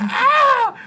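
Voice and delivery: loud, high-pitched scream; sudden shrieked "Ah!" acting out the fright; retold scream. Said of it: "I screamed".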